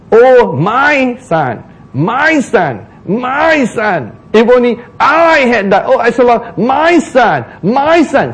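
high, drawn-out grieving wail; mock lament. Preacher imitating David's grief, high drawn-out lament. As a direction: mimic a father's self-pitying cry. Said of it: "Each one got".

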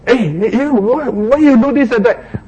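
high indignant "hey, you" at a stranger; mock indignant challenge. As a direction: imitate a pushy shopper calling out. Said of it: "they say".

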